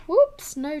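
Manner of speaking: loud startled whoops; alarmed whoops. Real, not acted. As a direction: blurt it out in alarm. Pencil about to break.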